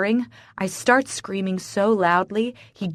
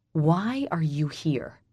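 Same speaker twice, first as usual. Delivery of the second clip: hiss; 2 semitones lower, 5 dB quieter, slower than usual. Acted. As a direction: hiss it at the unwanted woman. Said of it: "I hiss".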